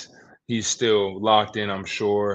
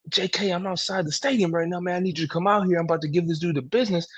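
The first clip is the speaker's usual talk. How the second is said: high, urgent retelling of a panicked player; imitating a frantic athlete begging for help; agitated player; 8 semitones higher than usual. Player calling his agent from outside the stadium, high and frantic.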